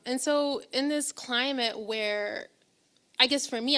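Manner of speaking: ordinary delivery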